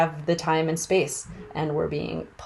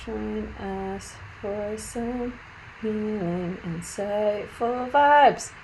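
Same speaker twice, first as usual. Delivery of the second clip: slow, absent-minded reading out as you type; drawn-out typing talk; 3 semitones higher, much slower than usual. Real, not acted. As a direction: stretch each word while composing a post. Composing the post aloud.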